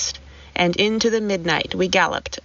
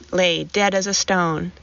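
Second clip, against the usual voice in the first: ordinary delivery, nothing marked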